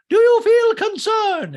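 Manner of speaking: shrill, loud, slow high squeak; hammed-up Dorit impression; mock Dorit. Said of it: "And Dorit goes".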